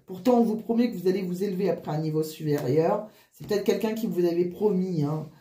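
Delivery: ordinary delivery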